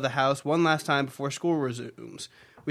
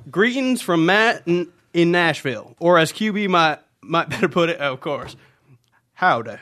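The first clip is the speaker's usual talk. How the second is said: louder put-on southern gentleman; southern gentleman accent; 5 dB louder than usual. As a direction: greet them in your best southern accent. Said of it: "that's my best southern gentleman".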